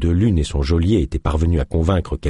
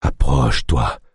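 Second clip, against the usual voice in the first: low, weary threat; menacing sick chief; 6 semitones lower than usual. A narrator's reading. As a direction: warn the stranger darkly.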